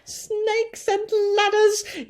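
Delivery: very high, loud and slow alarmed yell. A narrator's reading. Fire chief yelling in horror at the roof. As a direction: stretch out the alarmed cry. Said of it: "yelled the head of the fire department".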